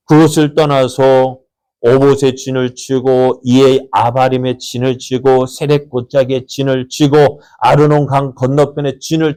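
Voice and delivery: slow, measured list of camps; slow recitation. Real, not acted. Preacher reading the camps to the congregation, slowly. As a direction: read each camp slowly.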